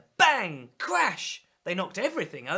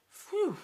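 relieved phew; 11 semitones higher than usual. Acted. Relief after the chase.

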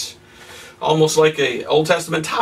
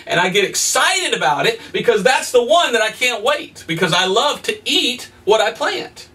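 usual speaking voice

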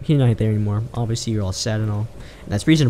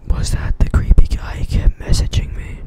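whispering; 4 dB louder than usual. Real, not acted. Whispers after the chase.

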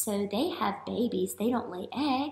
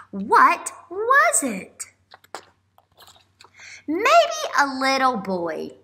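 louder storybook voice with slow, drawn-out suspense; reading to children; 3 semitones higher, 8 dB louder than usual. Acted. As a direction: read it aloud to wide-eyed kids, slowing on each guess. Reads picture book aloud.

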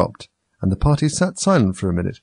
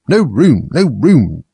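loud, insistent double cry; 5 semitones higher, 6 dB louder, much slower than usual. A narrator's reading. Tea party hosts shout at Alice, crying out. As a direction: call it out to block her. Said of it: "they cried out".

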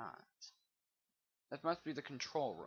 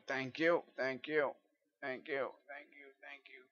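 sarcastic thanks; 4 semitones higher, 6 dB louder than usual. Real, not acted. Rage fading into mock thanks.

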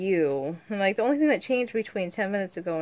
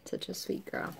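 whispered praise; 11 dB quieter than usual. Real, not acted. Mother whispering praise to her daughter.

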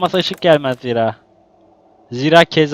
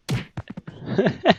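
breathless laugh; 6 semitones higher, 4 dB quieter than usual. Real, not acted. Laughing at the game.

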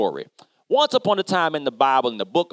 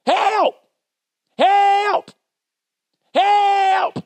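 high, loud cries for help from down a hole; yelling for help; 4 dB louder, much slower than usual. Retold or imitated. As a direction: call out like someone trapped and desperate. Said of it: "I hear this yelling".

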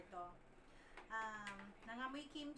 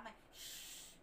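library whisper; 5 dB quieter than usual. Retold or imitated. Imitates the fizzing drink sound.

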